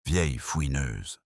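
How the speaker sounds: slow, understated bitterness; a touch of bitter contempt. A narrator's reading. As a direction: spit the insult out slowly, without raising the voice. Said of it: "Grayford cracha amèrement".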